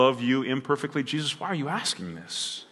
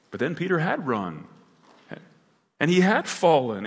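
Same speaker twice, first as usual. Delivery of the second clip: normal talking voice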